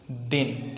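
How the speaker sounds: दिन with a brief, unstretched इ; दिन said quickly, the vowel barely held; short vowel. Shown as a demonstration. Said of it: "हमने इसे बहुत कम खींचा".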